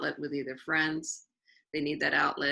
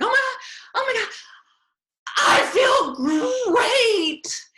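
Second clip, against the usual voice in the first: much higher, loud, euphoric cry of relief; overjoyed; 9 dB louder, slower than usual. Retold or imitated. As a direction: burst out in joy as the weight lifts. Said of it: "And he was so overjoyed".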